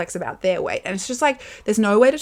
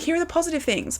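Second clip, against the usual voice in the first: chirpy; 9 semitones higher, 5 dB quieter than usual. Retold or imitated. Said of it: "I wanna be going to bed every night being like".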